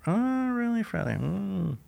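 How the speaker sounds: sarcastic aside. Retold or imitated. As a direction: tease him as if unimpressed. Mocking thought about Freddie's boast.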